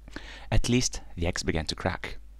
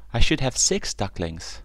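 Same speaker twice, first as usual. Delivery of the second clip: higher, louder worried mama duck; worried surprise; 6 semitones higher, 5 dB louder than usual. A narrator's reading. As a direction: sound alarmed at the count.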